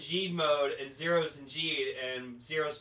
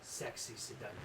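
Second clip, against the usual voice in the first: low, soft sultry voice; seductive deep voice; 4 semitones lower, 10 dB quieter than usual. Acted. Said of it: "very deep, sexy, seductive voice".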